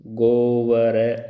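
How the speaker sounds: गोवर drawn out very slowly, go-va-ra; sounded out slowly. Shown as a demonstration. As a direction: stretch गोवर syllable by syllable. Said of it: "ये गोवर्धन हो जाएगा".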